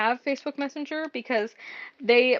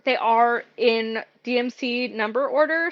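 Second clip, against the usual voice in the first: ordinary talk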